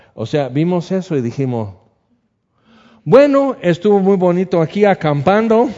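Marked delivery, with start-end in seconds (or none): 3.0-5.7 high, loud ironic cheer; hammed-up nervous irony; mock nervous retreat. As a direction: pretend cheerfully to end the trip fast. Family fleeing bear campsite.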